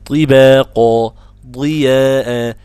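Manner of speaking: loud, slow طباق and ضياء with drawn-out vowels; tajweed demonstration. Shown as a demonstration. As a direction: model emphatic ط and ض before i. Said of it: "المرتبة الخامسة هي المكسور".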